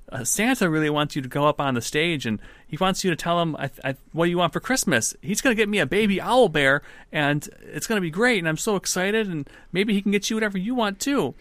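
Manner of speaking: put-on high, rushing childish gush; excited little boy. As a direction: bubble over like a thrilled kid. Boy gushes to lady about Santa.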